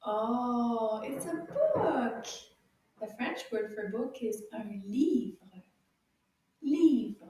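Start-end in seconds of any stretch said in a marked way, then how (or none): none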